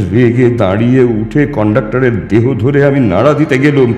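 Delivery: ordinary delivery, nothing marked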